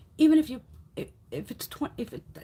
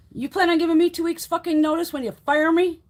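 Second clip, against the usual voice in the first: loud, higher, incredulous comeback; indignant challenge; 6 semitones higher, 5 dB louder than usual. Retold or imitated. Employee challenges bosses about notice, indignant. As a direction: throw it back at them, raised voice.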